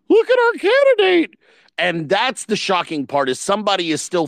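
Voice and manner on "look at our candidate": very high squeaky proud voice; mock squeaky proud voice. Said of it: "were like".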